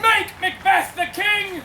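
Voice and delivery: slow, loud, high rallying cry; proclaiming shout. Acted. Nobles demanding that Macbeth take the crown, proclaiming shout. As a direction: lead the crowd in a drawn-out shout.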